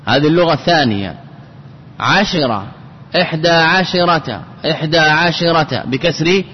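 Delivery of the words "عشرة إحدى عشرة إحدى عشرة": عشرة with a clear "shi" in the middle; shin with kasra. Shown as a demonstration. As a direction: say عشرة as "ʿa-shi-rata", short "i" after sh. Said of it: "بكسر الشين". (نطق عشرة بكسر الشين)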